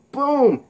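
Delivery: high punched-out "boom"; comic-book punch sound effect; impact boom. Acted. Voicing a kick's impact.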